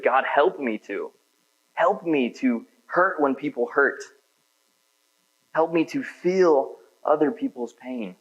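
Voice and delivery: slow pleading prayer; earnest prayer. Shown as a demonstration. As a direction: pray it slowly from the heart. Modelling a heartfelt prayer.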